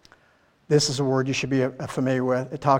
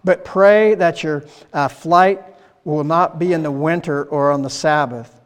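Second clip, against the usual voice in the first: louder, declaiming scripture reading; 3 semitones higher, 6 dB louder than usual. Acted. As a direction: proclaim the passage to the congregation. Reads Matthew 24 aloud.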